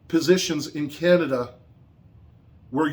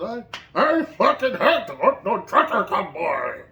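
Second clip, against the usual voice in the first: higher, strained shout of the quote; mock scream; 4 semitones higher than usual. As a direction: imitate someone screaming at a convoy.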